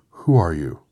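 loud, sudden challenge; crying out. A narrator's reading. Tin Woodman rushing the little man, crying out loudly. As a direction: demand it at a shout. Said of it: "and cried out".

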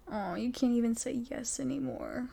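usual speaking voice

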